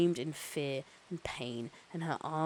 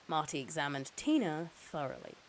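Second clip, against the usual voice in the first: ordinary delivery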